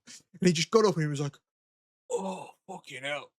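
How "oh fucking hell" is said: soft, slow mock groan of pain. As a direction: imitate a hurt man groaning under his breath. Man who was hit gets up hurting.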